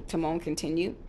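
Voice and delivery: ordinary delivery, nothing marked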